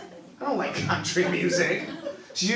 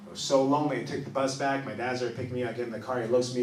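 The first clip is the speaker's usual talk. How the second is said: usual speaking voice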